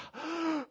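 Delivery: sharp high gasp from the cold; cold-water gasp. Retold or imitated. Dad retelling stepping into the chilly pool with a sharp high gasp. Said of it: "I'm like".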